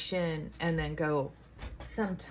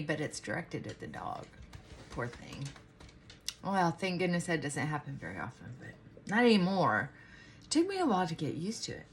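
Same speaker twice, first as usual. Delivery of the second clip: normal talking voice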